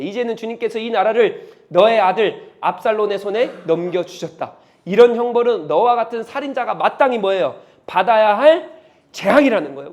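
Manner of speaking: loud, gloating curse; cursing. Acted. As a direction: rub the punishment in. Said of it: "막 저주를 퍼부는 거예요". (큰 소리로 저주를 쏟아붓는 목소리)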